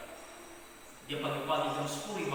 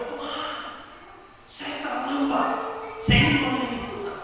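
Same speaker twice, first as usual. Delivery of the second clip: pleading prayer; 8 semitones higher, 5 dB louder than usual. Retold or imitated. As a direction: beg God like a late pastor. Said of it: "dia berdoa". (doa memohon dengan suara lebih tinggi)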